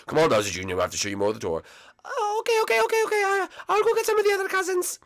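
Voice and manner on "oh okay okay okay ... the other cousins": put-on very high, quick little-kid voice; high-pitched cousin greeting everyone; squeaky cousin voice. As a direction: chirp it like a hyper toy character.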